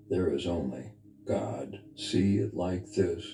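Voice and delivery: normal talking voice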